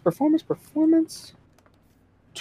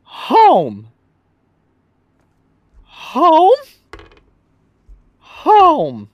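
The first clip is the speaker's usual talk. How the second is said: slow, explaining; 2 semitones lower, much slower than usual. Acted. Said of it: "point to the fort and say".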